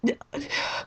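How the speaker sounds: soft, breathless embarrassed laugh; whimpering giggle of shame; cringing little laugh; mortified laugh. Retold or imitated. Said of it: "I'm probably sitting there like".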